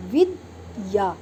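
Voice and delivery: विद्या said slowly, द and या both heard; slow vidya. Shown as a demonstration. Teacher to her pupils, slow clear विद्या. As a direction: draw out विद्या syllable by syllable. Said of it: "द और या दोनों की ही ध्वनि".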